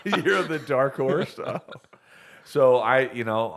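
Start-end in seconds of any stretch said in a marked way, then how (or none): 0.0-1.6 loud, high, laughing repeat. Real, not acted. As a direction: crack up while repeating the title. Repeats the title while cracking up.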